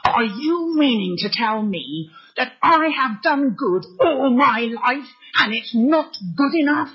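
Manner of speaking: offended man protesting through clenched teeth. Retold or imitated. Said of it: "he said between clenched teeth".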